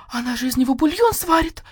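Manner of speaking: ordinary delivery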